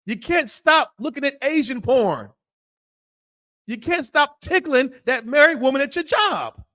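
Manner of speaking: high, rising sermon rebuke; preacher scolding the congregation with rising fire; fiery preaching. Acted. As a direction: hammer the sinners from the pulpit. Preaching at full force to congregation.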